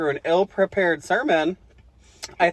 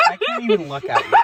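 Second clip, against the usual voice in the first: laughing; faster than usual. Real, not acted. Laughing at the texted message.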